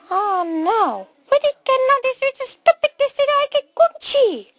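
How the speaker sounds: high squeaky cartoon mouse. Mouse finding the exit locked, high mouse squeak. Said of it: "no more mouse voice".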